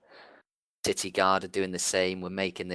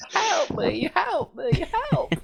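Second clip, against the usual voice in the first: very high, squealing dying pleas; playful falsetto death cries; mock cries of horror; 3 dB louder, slower than usual. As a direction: beg for mercy in a hammed-up high voice. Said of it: "Just cries of horror as it dies".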